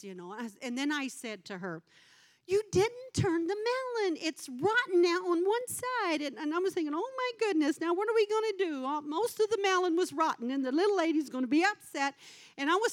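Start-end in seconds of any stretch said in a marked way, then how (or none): 2.5-6.2 high-pitched retold telling-off; retold scolding. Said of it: "a right to be yelled at". 6.9-8.9 high, fretting inner voice; retold dismay. Retold worried thought over rotten melon.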